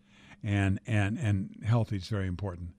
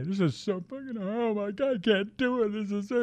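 mock high-pitched wail of overwhelm; struggling actor; 9 semitones higher than usual. Actor complaining to a veteran about a hard day, high mock whine. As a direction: imitate an actor whining in despair. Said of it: "actors who are having a hard time today".

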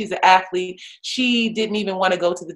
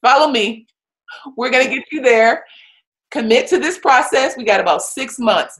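firm rallying coach; 6 semitones higher, 5 dB louder than usual. Retold or imitated.

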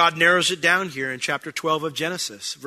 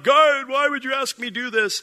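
high, louder mock wail to God; mock anguished cry; 9 semitones higher, 4 dB louder than usual. Pastor mocking a TV Abraham crying out to God, high mock wail. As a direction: ham up a pining, crying Abraham. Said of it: "shows that show you Abraham pining and crying out to God".

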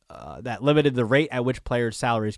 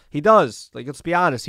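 ordinary talk

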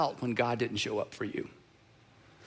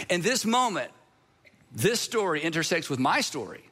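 ordinary delivery

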